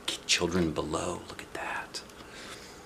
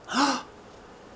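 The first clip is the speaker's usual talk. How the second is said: startled gasp; 9 dB quieter than usual. Acted. Candle blown out.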